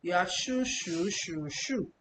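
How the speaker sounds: "shu" said in four tones in turn; four Chinese tones. Shown as a demonstration. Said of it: "So you need to know which shu".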